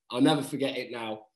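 ordinary talk

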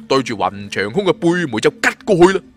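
plain reading voice

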